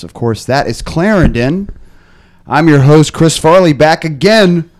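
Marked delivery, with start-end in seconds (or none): none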